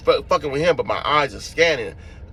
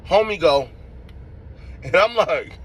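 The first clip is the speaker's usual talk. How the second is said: ordinary talk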